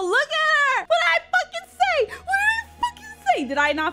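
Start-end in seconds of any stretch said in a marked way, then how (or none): 0.0-2.1 high, loud told-you-so shout; excited shout. Real, not acted. Streamer gloating that his call about [name] came true, loud. 2.2-3.4 even higher, faster, laughing as he yells; laughing shout. Real, not acted. Streamer repeating his told-you-so, laughing and shouting. Gloating, prediction came true.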